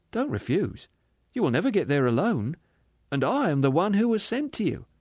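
pleading spirit. Acted. Spirit entreating the ghost to come, earnest. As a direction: entreat him tenderly.

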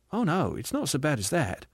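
gentle, lilting contradiction; reassuring spirit. Acted. Spirit comforting the aggrieved ghost, gently reassuring. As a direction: reassure lightly, easing down at the end.

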